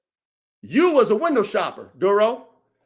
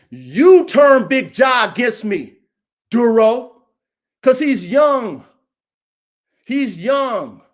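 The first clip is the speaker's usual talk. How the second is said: accusing; 6 dB louder, much slower than usual. Real, not acted. Accusing Duro of turning Big Ja.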